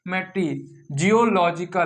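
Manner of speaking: normal talking voice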